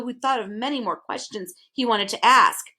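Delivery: plain reading voice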